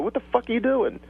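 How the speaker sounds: mock-yell at the lazy cashier; angry outburst. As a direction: shopper fed up with a cashier on her phone, in a mock angry outburst. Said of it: "you just wanna smack 'em".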